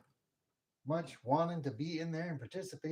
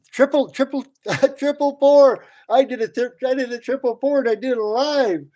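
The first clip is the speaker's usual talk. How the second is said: much higher and louder, bursting with glee; excited; 11 semitones higher, 15 dB louder than usual. Real, not acted. Caster bragging to viewers about his live triple pour, thrilled. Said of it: "I know you guys can hear the excitement in my voice".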